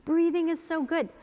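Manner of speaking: mocking the breathing fans; mock cheerful advice. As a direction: imitate everyone preaching breath. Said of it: "everybody's like".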